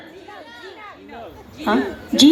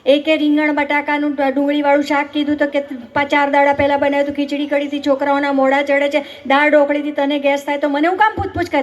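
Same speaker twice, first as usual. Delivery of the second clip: usual speaking voice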